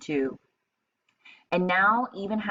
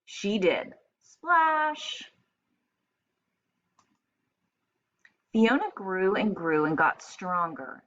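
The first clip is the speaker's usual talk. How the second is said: storybook read-aloud for a class; lively picture-book narration; reading to children; 2 semitones higher than usual. Acted. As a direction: read it like story time on the rug. Teacher reads picture book aloud.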